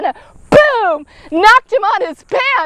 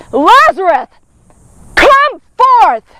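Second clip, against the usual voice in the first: commanding call; 5 dB louder, slower than usual. Acted. Jesus calling dead Lazarus out of the tomb, loud command. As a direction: call him out of the tomb with authority.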